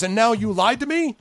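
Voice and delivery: high, loud, affronted goblin boss; outraged boss confronting a liar; indignant accusation. Acted.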